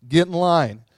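loud, cocky brush-off; arrogant dismissal. Retold or imitated. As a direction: bark it like a big shot. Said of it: "And he could have said".

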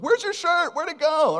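high, loud mock exclamation; surprised. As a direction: act out a startled question. Mock quote of surprised onlooker.

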